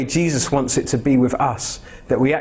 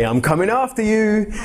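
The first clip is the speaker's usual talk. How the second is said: much higher, sing-song teasing threat; playful daddy tease; 7 semitones higher than usual. Retold or imitated. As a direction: chase the toddler in play. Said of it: "and then I say".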